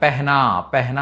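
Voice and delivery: పెహ్నా said peh-na, with e after p; the Hindi e sound in పెహ్నా, not pah-na; పెహ్నా with an added e vowel; Hindi pronunciation. Shown as a demonstration. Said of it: "దీన్ని పెహ్ అని చదువుతారన్నమాట".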